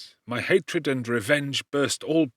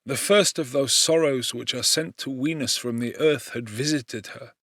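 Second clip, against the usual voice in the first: ordinary delivery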